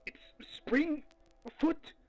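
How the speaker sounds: high breathless whisper of fear; terrified whisper. Acted. Archivist whispers into his recorder as the creature presses close, terrified. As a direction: whisper so it won't hear you.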